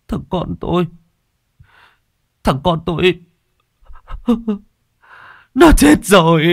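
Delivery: high, breaking voice rising to a loud wail; grief-stricken father. A narrator's reading. Grieving father tells Bình his son is dead, wailing. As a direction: cry it out, voice cracking.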